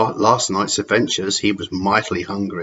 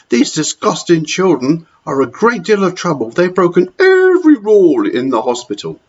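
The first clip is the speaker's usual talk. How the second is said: higher, harsh matron scolding; stern Matron; 8 semitones higher, 5 dB louder than usual. Acted. Matron scolding children to the dinner lady, harsh and higher. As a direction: sneer at the children.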